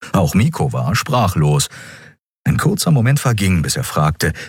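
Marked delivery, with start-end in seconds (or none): none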